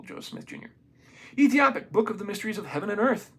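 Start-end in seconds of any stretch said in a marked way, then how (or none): 0.0-0.7 whispered aside. Real, not acted.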